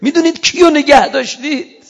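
high, loud, crying out; preacher voicing Imam Hussein crying out, loud and tearful; tearful shout. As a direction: shout through tears to let him go. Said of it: "داد زد امام حسین".

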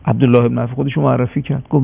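ordinary talk

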